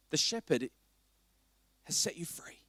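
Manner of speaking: soft, low, almost breathless; tender hush about the shepherd. Real, not acted. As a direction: drop to a near whisper. Tender shift after fighting talk.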